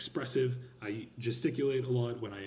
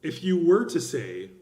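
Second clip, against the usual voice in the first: were punched louder and slower; stress on were; 4 semitones higher, 9 dB louder than usual. Shown as a demonstration. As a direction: lean hard on were. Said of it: "You get what I'm doing there?".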